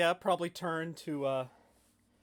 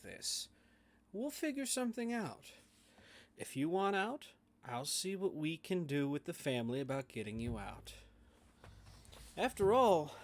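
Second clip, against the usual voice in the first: soothing Joker; 5 dB quieter than usual. Acted. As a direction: placate her as if it's all family.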